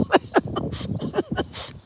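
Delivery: high breathless laughing fit; real, helpless laughter; cry-laugh. Real, not acted. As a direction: laugh as if crying. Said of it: "I'm laughing, but I'm crying".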